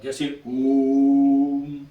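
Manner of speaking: ओम stretched out long, like calling someone; prolonged calling vowel on ओम; drawn-out call. Shown as a demonstration. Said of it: "किसी को पुकारने के लिए इसका प्रयोग".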